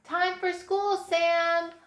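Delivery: high, loud singsong call. A narrator's reading. Mom calling her son for school, calling out. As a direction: holler it up to a child. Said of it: "Mom called".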